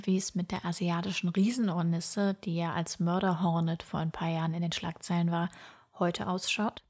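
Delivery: ordinary delivery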